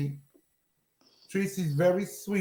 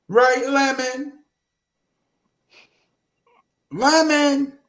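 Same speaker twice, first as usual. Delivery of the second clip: higher, drawn-out call for an answer; 4 semitones higher, 4 dB louder, slower than usual. Real, not acted. Host to the silent Lemon, calling out. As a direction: call out to someone not answering.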